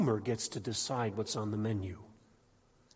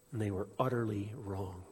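ordinary talk

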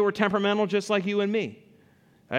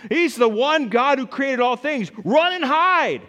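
higher, louder hammed-up panic; mock panic; 8 semitones higher, 5 dB louder than usual. Preacher imagining a terrified worshipper's reaction, hammed-up panicked cry. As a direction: cry out in pretend fright, run and hide.

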